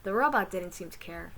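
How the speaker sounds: ordinary delivery, nothing marked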